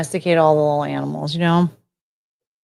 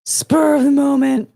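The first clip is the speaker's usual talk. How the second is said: higher, louder, slow theatrical delivery; odd dramatic voice; 7 semitones higher, 6 dB louder than usual. Acted. Streamer blurting a phrase to chat, hammed-up and theatrical. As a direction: stretch it out like a stage ham. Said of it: "Don't know why I had to say it that way".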